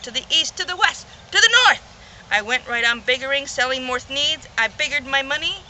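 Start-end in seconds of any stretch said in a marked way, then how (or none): 0.0-1.8 each direction higher and louder to a loud peak; rising list to a triumphant shout; building excitement. Acted. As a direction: climb up to a shout on north.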